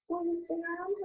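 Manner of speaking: put-on high-pitched, shy Southern accent; timid question in a high Southern accent. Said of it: "has to speak like little Southern accent".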